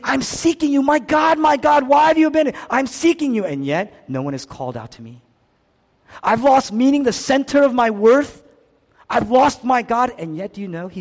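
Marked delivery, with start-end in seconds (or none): 0.0-3.5 high, loud pleading cry; desperate calling out. Acted. Preacher voices Jesus seeking his God, desperate cry. 6.2-10.1 loud, high cry of loss; anguished lament. Acted. Preacher voices Jesus who lost his God, anguished lament.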